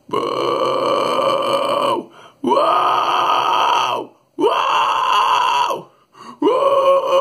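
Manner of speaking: long raspy vocal fry "whoa"; sustained fry noise, like a contained scream. Shown as a demonstration. Demonstrating the basis of the fry scream, vocal fry noise. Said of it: "So the vocal fry noise sounds like this".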